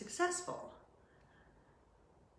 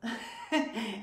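bright laugh; 5 semitones higher, 4 dB louder than usual. Real, not acted. Laughs at own joke.

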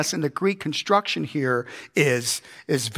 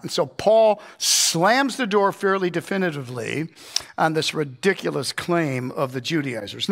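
ordinary talk

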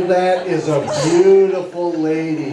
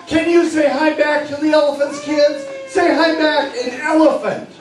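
excited parade story; 5 semitones higher than usual. Acted. Hypnotist walking the six-year-olds through the zoo parade, bright and eager. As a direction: paint the parade like a thrilled children's entertainer.

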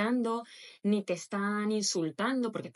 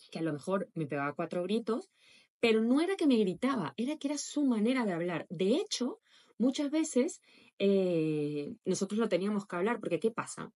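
ordinary talk, nothing marked

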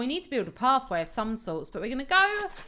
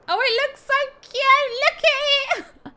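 beam over the tree; thrilled; 12 semitones higher, 6 dB louder than usual. Real, not acted. Showing viewers her tree, high and thrilled.

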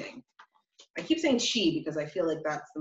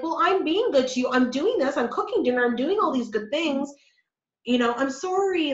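mock people-pleaser; 7 semitones higher, 5 dB louder than usual.

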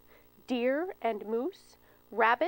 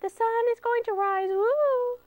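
very high, louder squeaky cheer; piping squirrel; 12 semitones higher, 5 dB louder than usual. Acted. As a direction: pipe up triumphantly like a tiny squirrel. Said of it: "Little Brown Squirrel piped up".

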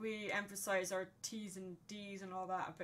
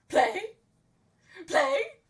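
loud, high, yelping dog voice; excitable Rottweiler; 14 semitones higher, 15 dB louder, slower than usual. Acted. Imitating a film's friendly Rottweiler, high excited shouting. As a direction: shout like an overexcited dog begging to play. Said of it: "it's just like".

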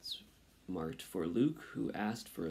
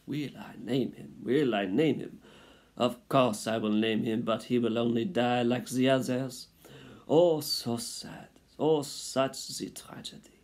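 melodramatic French mother mouse, raised pitch; French accent; 3 semitones higher, 4 dB louder than usual. A narrator's reading. Mother answers father about naming, melodramatic French accent. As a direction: lament grandly in a French accent. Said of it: "She was a French mouse".

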